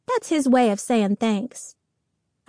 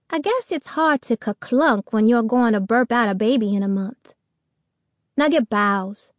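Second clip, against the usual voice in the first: ordinary delivery, nothing marked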